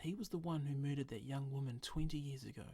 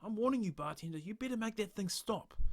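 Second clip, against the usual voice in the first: high, loud angry outburst; furious; 7 semitones higher, 5 dB louder than usual. Acted. Stranger furiously warning the bartender to stop the hand. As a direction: rage at the bartender, warning him. Stranger loses temper at bar.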